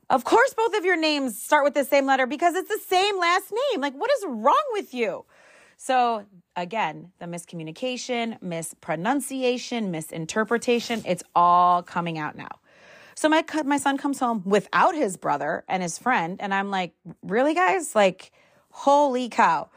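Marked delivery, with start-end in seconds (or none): 0.1-5.2 higher, incredulous mom scolding; exasperated disbelief. Retold or imitated. Mom scolding twins over last name. 17.2-19.7 exasperated. Retold or imitated. Mom exasperated at sons.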